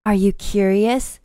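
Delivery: mock sultry whisper. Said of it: "she whispered in my other ear".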